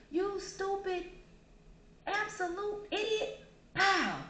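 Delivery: high-pitched, drawn-out angry outburst; angry insults. Acted. Attacker in a made-up scenario punching someone, angry. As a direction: hurl the insult high and hard, then hit it. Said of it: "and it was angry".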